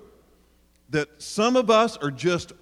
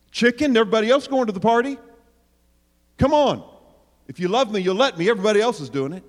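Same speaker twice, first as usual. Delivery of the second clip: peer pressure; 5 semitones higher, 4 dB louder, faster than usual. Acted. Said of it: "they're going to perform for their friends".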